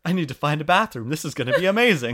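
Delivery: fast, thrilled character voice; excited. Retold or imitated. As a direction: rush it out, overjoyed. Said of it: "So excited".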